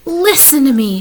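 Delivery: loud urgent call; crying out. A narrator's reading. Wilbur crying out to Templeton for help, urgent. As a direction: demand the rat's attention. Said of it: "cried Wilbur".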